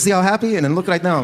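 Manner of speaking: high mock dad showing photos; dad points at old photo then now, high voice; dad's voice. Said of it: "He goes".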